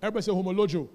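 ordinary delivery

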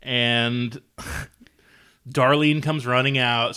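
normal talking voice

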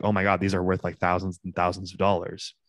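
ordinary talk, nothing marked

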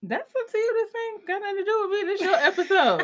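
playful high-pitched brush-off; squeaky laughing sass; high teasing sass. Real, not acted.

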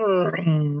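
imitated engine rumble; throaty car sound effect; car passing noise. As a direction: make the vroom of a passing car. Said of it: "a car went".

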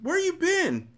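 very high, whining addict's plea; begging. A narrator's reading. Addict mother pleading with her dealer, high begging whine. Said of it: "I heard her begging".

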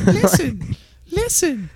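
high squeaky fairy nag; Navi voice. Pretend. Imagining Navi as a jerk, high squeaky cry. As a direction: chirp it like a pestering fairy. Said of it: "just imagine that".

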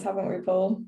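ordinary talk, nothing marked

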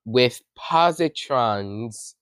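POSITRONS said slowly and deliberately; slow, careful correction on "positrons"; stress on positrons. Real, not acted. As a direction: carefully spell out "positrons" by ear, each syllable drawn out. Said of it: "not positions".

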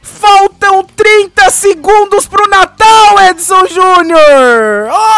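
high, loud and slowly stretched announcement; drawn-out excited shout. Real, not acted. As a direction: stretch every word like an overexcited announcer. Announcing that Christmas is approaching.

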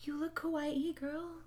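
soft, high, mock-sad voice; hammed-up quiet sadness; said sadly. A narrator's reading. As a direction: say it small and sorrowful. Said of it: "Bloody Mary said sadly".

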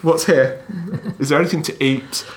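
voicing the dog, high and loud. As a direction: play the dog, puzzled and eager. Dog voiced by owner.

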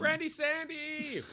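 high, slow, drawn-out squeal; high sing-song cheer. Real, not acted. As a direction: stretch it out way up high. Cheering the author's nickname.

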